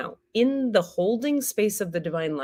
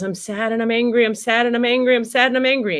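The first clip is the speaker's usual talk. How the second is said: louder, pleading, hurt and angry; repeating it with growing upset; upset inner child; 4 semitones higher, 6 dB louder, faster than usual. Acted. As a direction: let the hurt part finally speak out. Voices the exiled part speaking.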